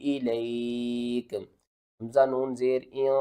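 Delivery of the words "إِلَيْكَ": Quran recitation. Acted. Teacher reciting the verse for students, melodic. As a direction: recite the verse in measured, tuneful tarteel.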